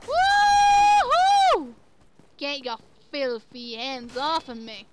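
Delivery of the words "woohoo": high, loud whoop of joy; excited whoop. Real, not acted. Gamer whooping at the screen, loud and high. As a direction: let out a big gleeful woo.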